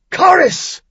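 a warrior's full-throated war cry; booming battle cry. A narrator's reading. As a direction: roar the battle cry, high and loud. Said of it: "Merlin's deep voice boomed the battle cry".

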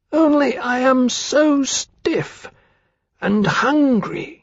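very high, slow frail hatchling; small, pale voice. A narrator's reading. Newborn dragon answers Temeraire in a small, pale voice. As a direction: pipe it faintly and haltingly, high-pitched. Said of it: "said in its small, pale voice".

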